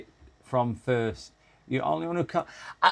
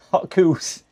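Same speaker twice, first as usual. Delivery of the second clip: playful Irish accent, higher and louder; 6 semitones higher, 6 dB louder than usual. Shown as a demonstration. Streamer joking over the word cows, Irish accent. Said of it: "I can do an Irish accent".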